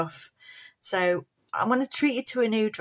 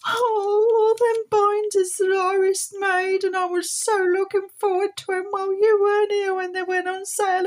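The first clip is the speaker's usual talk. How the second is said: sad puppet voice; 10 semitones higher, 4 dB louder than usual. Pretend. Puppet Polly laments binder sale.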